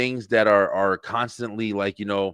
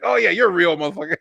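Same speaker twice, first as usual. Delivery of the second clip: mock surprised; 7 semitones higher, 5 dB louder than usual. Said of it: "You're like".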